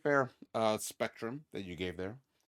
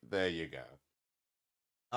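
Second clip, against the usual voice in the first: very slow drawn out; 4 semitones lower, much slower than usual. Shown as a demonstration. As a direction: stretch There you go out slowly.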